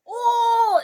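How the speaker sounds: long, loud, high-pitched "oh"; high held swoon; gushing oh. Real, not acted. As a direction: coo it high and loud in admiration. Gushing over [name]'s look.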